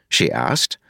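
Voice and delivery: ordinary delivery, nothing marked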